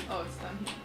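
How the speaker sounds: normal talking voice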